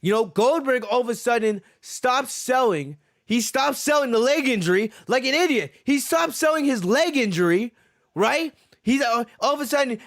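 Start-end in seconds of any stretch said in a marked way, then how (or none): none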